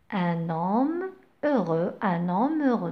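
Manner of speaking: "Un homme heureux" said slowly, N linked into homme; slow liaison demo. Shown as a demonstration. Teacher slowly modelling liaison for the class. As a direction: drag it out and link the N into homme. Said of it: "on fait la liaison".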